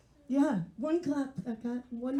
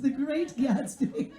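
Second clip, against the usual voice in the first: mock announcer; slower than usual. Announcer intro of Gatsby.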